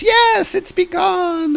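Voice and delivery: very high, drawn-out squeal of glee; excited Hermes. Retold or imitated. God thrilled the fight is starting, high excited cry. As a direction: squeal it slowly in a high put-on voice. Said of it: "Hermes is really excited about this".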